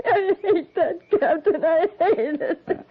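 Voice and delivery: tearful, bitter half-laugh; crying. Acted. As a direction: voice breaking with tears, high and slow at the end. Widow breaks down leaving.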